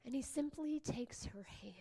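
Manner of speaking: a hushed sermon aside; hushed whisper. Acted. Preacher whispering the miracle to the congregation. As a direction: whisper it, leaning in close.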